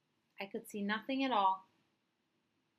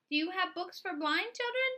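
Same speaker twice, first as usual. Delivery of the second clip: put-on child's question; 10 semitones higher than usual. Child asks again.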